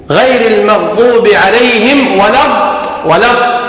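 slow, stopping on doubled D in الض; shadda on the dad. Shown as a demonstration. Teacher shows the tenth shadda, pressed dad in الض. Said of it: "لا بد من تشديد الضاد".

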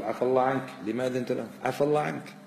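soft, low, tender reproach; gentle reproach. Retold or imitated. God gently chiding the Prophet, gentle reproach. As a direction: chide softly, with forgiveness first. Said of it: "في عتاب لطيف".

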